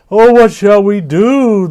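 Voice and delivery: high, loud wailing moan. Acted. Frightened creatures moaning to each other, loud high wail. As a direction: cry out in despair. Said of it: "the night creatures moaned".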